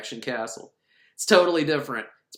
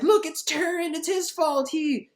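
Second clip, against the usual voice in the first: goblin voice; 14 semitones higher than usual. Retold or imitated. Little goblin blaming Taran in a high whiny voice. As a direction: pipe up like a tattling little goblin. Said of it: "The little goblin thing's like".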